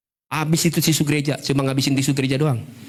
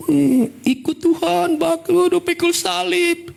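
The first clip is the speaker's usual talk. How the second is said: high, drawn-out mock groan of effort; struggling climber; much slower than usual. Believer toiling up the slide to follow God, struggling. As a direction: haul each word uphill, slow and high. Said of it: "Naiknya susah".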